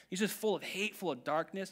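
normal talking voice

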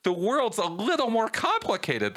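rising pitch, incredulous at a silly theory; voice gets high. Retold or imitated. Arguing with conspiracy theorists, exasperated high-pitched disbelief. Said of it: "my voice gets high".

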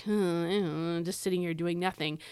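lightly acted bored waiting; a hummed, half-hearted show of doing nothing; mock idle waiting. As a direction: play at waiting idly. Mock example of idle, passive waiting.

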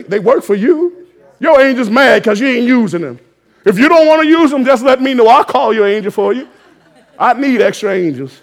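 loud, high preacher's shout; rising to a roar over the congregation; full-force preaching. Acted. Preacher to congregation, calling angels in a full-force shout.

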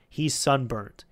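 ordinary talk, nothing marked

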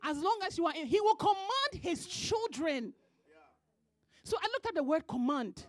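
loud, high preacher's delivery; loud sermon cadence, hammering each line; full-force preaching. Acted. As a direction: preach it hard to the congregation.

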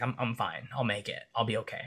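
soft, understated reassurance; calm composure. Retold or imitated. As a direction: retell it quietly, cool and collected. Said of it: "cool, calm, collective composure".

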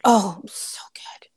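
hushed, overwhelmed by the scene; awed whisper. Real, not acted. Gushing to her co-host about the scene, whispered. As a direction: breathe it out in a whisper.

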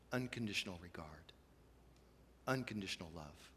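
softly whispered, almost breathless; hushed whisper. Real, not acted. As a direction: let the words fall to a whisper. Hushed closing emphasis.

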